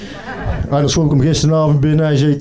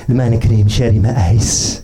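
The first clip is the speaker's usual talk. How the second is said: slow lower lady voice; 5 semitones lower, slower than usual. Retold or imitated. Third lady orders.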